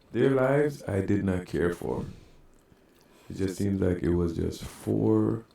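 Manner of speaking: ordinary talk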